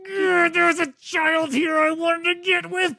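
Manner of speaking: high, slow mock crying; hammed-up blubbering confession; mock tearful confession. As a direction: whimper it like a fake sob.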